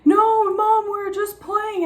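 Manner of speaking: imitating her son, high kid voice; Levon's voice. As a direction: whine it like a kid insisting it's fine. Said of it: "And then he's like".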